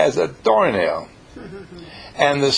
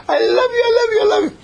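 much higher, louder, near-shouting cries of love; ecstatic gushing; 4 dB louder, much faster than usual. Acted. Sylvia crying out her love to Ingeborg, high ecstatic shout. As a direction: burst out with tearful joy.

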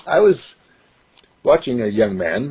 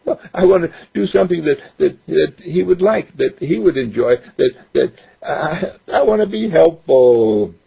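put-on higher boy's voice, eager; young boy voice; 7 semitones higher, 3 dB louder than usual. Boy explains he only got in dad's way, higher boyish voice. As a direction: voice a ten-year-old who wants to help. Mark speaks.

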